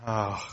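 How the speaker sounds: soft, heavy exhale; exasperated sigh. Acted. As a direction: sigh out your frustration.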